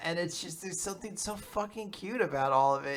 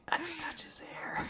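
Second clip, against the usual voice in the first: high, breath-only secret; whispered longing; 9 semitones higher, 10 dB quieter than usual. Retold or imitated. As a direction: whisper it like a shy wish. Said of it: "She's just like".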